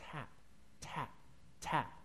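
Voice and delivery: very soft, faint tap, tap, tap; soft tapping noise. Retold or imitated. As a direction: tap it out quietly like a distant knock. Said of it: "noise coming from above".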